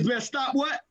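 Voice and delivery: ordinary talk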